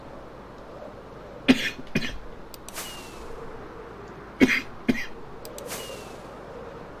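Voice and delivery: long dry coughing fit. Real, not acted. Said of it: "Sorry about all the coughing".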